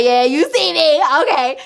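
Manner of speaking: smug. Retold or imitated. Answering coworker's knowing look.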